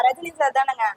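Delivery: ordinary delivery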